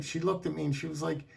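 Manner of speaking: usual speaking voice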